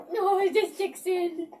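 ordinary talk

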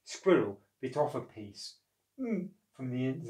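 plain reading voice

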